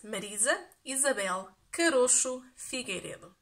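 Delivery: Marisa Isabel Carocho Figueiredo said the Portuguese way; proud native Portuguese pronunciation. Shown as a demonstration. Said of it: "tell you my Portuguese name in Portuguese".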